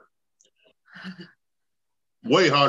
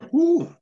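high whoop. Real, not acted. Reaction to the heat.

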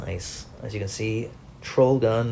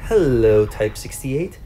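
gloating hello; 7 dB louder, slower than usual. Real, not acted. Springing ambush on enemy tank.

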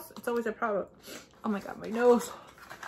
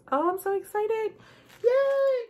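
high, bright squeal of joy; excited cheer; 12 semitones higher, 4 dB louder, slower than usual. Real, not acted. Unboxing day three for viewers, an excited cheer.

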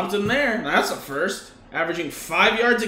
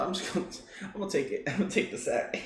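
laughing; 8 dB quieter, much faster than usual. Real, not acted. Laughing at own bad play.

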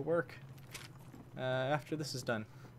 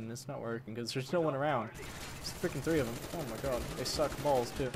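usual speaking voice

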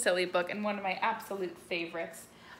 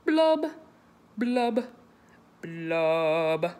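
each blub lower, last one deep; descending blubs; 3 semitones lower, 5 dB louder, much slower than usual. Acted. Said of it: "makes his voice go lower and lower".

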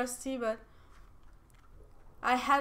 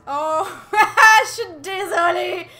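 higher and louder through laughter; laughing apology; 6 semitones higher, 13 dB louder than usual. Real, not acted. Player apologising to her team, laughing. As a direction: apologise while cracking up.

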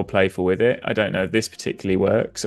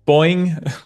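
springy boing; 7 semitones higher, 4 dB louder than usual. Pretend. Playful sound-effect word.

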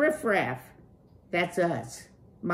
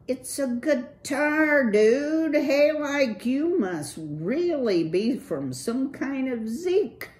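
higher, loose slacker-dude voice; Clutch, cool dude; 10 semitones higher, 6 dB louder than usual. Acted. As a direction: play the laid-back streetwise rocker.

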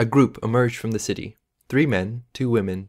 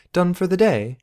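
woman's voice; 9 semitones higher than usual. Acted. Female character's dialogue.

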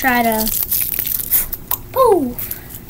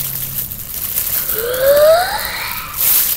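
sharp squealing gasp; 12 semitones higher than usual. Real, not acted. Reacting to more helium.

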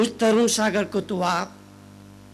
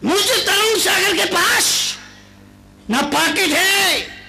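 rousing preacher; preaching at full force; 7 semitones higher than usual. Acted. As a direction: build each line to the punchline. Preacher builds to punchline.

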